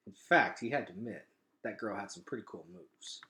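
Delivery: usual speaking voice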